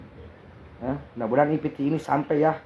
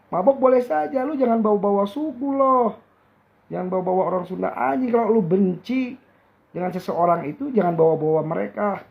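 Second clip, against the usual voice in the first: furious shout; 6 semitones higher than usual. Real, not acted. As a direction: yell it out, outraged for your people. Furious rant defending the Sundanese.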